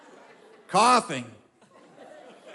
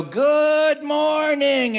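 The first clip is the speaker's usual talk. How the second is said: cheery morning greeting; 7 semitones higher, much slower than usual. Retold or imitated. Camp counselor Wally jumps out of bed, high slow cheery greeting. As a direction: sing it out like a chipper early riser. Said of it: "he'd go".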